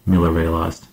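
usual speaking voice

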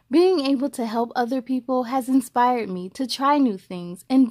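clear, even read-aloud voice; story narration. A narrator's reading. Host reading a picture book to young viewers, storytelling voice.

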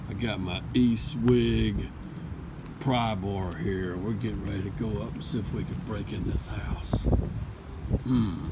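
lightly voiced sneaky thief; creeping up like a burglar. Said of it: "I'm gonna pretend like I'm a burglar".

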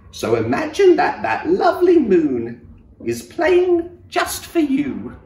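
high, gentle cricket voice; little cricket voice. Acted. Cricket advising the sad giraffe in a high gentle voice. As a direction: lift it up into a tiny kind voice.

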